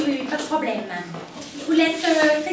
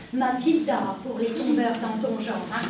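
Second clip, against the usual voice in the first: sultry flirt; 6 semitones lower, 6 dB quieter than usual. Acted. Flirty alias for client.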